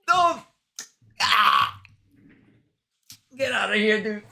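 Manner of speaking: high-pitched yelp while sucking candy; reacting to sour candy, mouth puckered; eating sour candy. Real, not acted.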